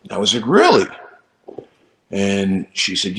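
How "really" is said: high, loud disbelieving really; surprised. Retold or imitated. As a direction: gasp it out in disbelief. Surprised at invitation.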